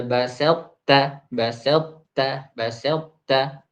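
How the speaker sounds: basalta drawn out, ta kept heavy and full; slow repeated demo of basalta. Shown as a demonstration. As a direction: drill basalta slowly, holding the heavy ta. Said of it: "Jadi, eh".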